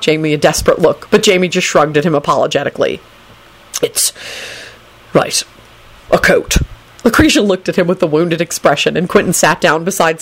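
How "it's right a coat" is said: halting, long-paused attempt; haltingly noncommittal; awkward stalling. A narrator's reading. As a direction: grope for a compliment and give up. Quentin fails to compliment the coat.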